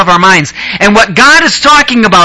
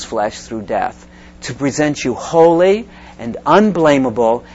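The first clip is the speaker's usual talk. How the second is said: scripture reading; 5 semitones lower, 6 dB quieter than usual. Acted. Reads scripture aloud.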